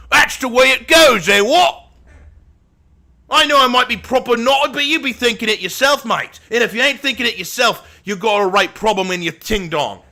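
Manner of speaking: high-pitched, exaggerated British accent; over-the-top British. Streamer defending a hot take to viewers in an overdone British accent. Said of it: "went a little too British there".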